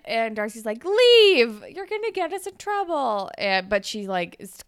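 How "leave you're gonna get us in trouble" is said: loud, high mock order to leave; mock scolding. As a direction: act out shooing someone away, voice raised. Darcy tells Peter to leave.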